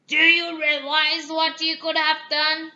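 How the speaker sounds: loud, furious beast growl; angry beast demanding an answer. Acted. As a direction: roar it at her like a cornered beast. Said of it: "he growled".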